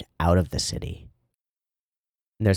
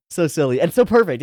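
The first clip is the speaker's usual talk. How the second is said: excited; 8 semitones higher, 6 dB louder than usual. Real, not acted. Repeated comment, louder.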